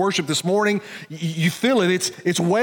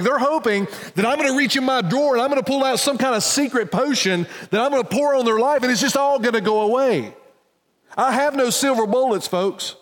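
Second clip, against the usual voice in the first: preaching at full force, pitch rising higher; impassioned preaching; 7 semitones higher than usual. Acted. Pastor urging the congregation toward God's grace, impassioned preaching. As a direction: lift the congregation with urgent hope.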